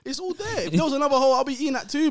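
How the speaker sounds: high animated rant; worked up and pitched high; excited. Real, not acted. Excited rant about satisfaction.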